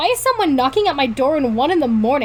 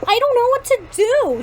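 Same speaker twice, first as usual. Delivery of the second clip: crying out; 10 semitones higher than usual. A narrator's reading. Girl torn over a boy who likes her, crying out. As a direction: wail it mildly, pitched well up. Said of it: "she cries".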